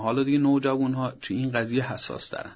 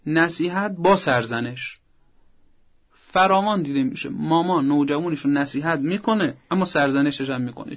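ordinary talk, nothing marked

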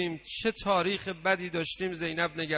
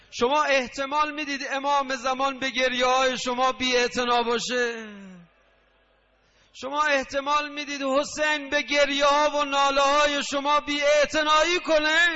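high, pleading preacher's cry, building louder; raised lamenting sermon voice, swelling to a shout; impassioned preaching; 5 semitones higher, 3 dB louder than usual. Real, not acted. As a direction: cry out an urgent appeal to the congregation. Preacher's impassioned appeal to congregation.

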